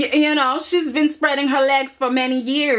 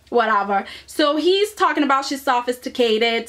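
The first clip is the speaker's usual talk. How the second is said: normal talking voice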